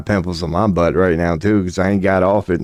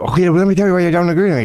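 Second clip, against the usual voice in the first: much higher, rapid-fire know-it-all voice; fast-talking debater; 10 semitones higher, much faster than usual. Retold or imitated. Streamer mocking Ben Shapiro's debate style, rapid high know-it-all.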